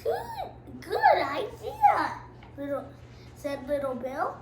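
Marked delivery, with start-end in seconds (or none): 0.0-2.2 much higher, very slow put-on voice; squeaky kid voice. Little Bill agreeing to show Mama, squeaky and slow. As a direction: ham up a tiny excited child. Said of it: "why am I making that voice".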